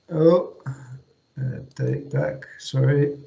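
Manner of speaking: ordinary talk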